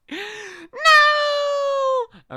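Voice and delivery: sudden high shriek of protest; a big high yelp of no; shocked scream. Real, not acted. Streamer reacting to the video, shrieking in shock.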